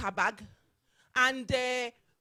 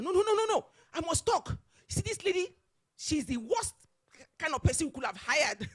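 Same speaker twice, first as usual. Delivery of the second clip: imitating a boss who refuses to calm down; high, loud, insistent mock rant; retold shouting; 7 semitones higher, 5 dB louder than usual. Chairman overruling staff to denounce her, shouting. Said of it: "the man shouting at her".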